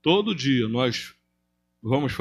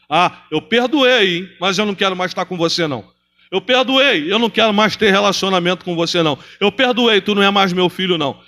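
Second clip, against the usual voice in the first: high, loud, sneering; mock resentful whine; 5 semitones higher, 5 dB louder than usual. Pastor mocking people who forgive but cut ties, in a high sneering voice. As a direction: ham up the grudging forgiver.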